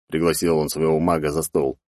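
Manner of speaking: ordinary delivery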